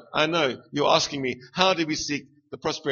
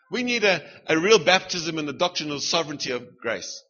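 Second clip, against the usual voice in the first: usual speaking voice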